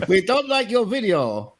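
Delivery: badly faked Russian accent; hammy mock Russian Kremlin voice. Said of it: "That was a really bad Russian accent".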